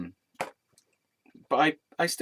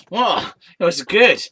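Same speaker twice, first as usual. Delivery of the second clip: loud, high, gushing delight; excited exclamation; 7 semitones higher, 9 dB louder than usual. Real, not acted. Storyteller recalling their only good experience, excited. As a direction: burst out with enthusiasm.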